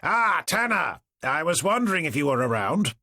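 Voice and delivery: high, hearty booming call across the room. A narrator's reading. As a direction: boom out a jovial greeting. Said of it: "Forrester's booming voice".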